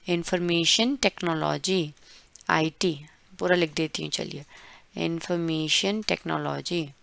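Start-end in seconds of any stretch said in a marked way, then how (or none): none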